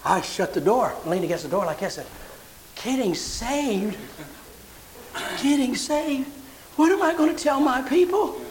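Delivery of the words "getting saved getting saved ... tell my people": rising from stunned hush to high alarm; mock horror at the news; shocked disbelief.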